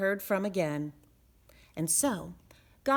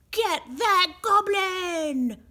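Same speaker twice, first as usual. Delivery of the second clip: slow, loud, much higher shout; rally the chase; mob shout; 10 semitones higher, 7 dB louder, slower than usual. A narrator's reading. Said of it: "shouted everyone".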